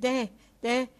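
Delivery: loud, high calls to the team; gleeful driver's shout. A narrator's reading. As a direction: yell short cries to spur the horses on. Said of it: "diye bağırmaktan hoşlanıyordu".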